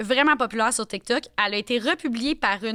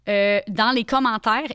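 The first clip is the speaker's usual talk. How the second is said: normal talking voice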